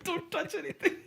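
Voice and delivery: high, laughing string of panicked refusals; rising into a squeaky, giggly mock panic. New assistant overwhelmed in the mastering room, mock panic.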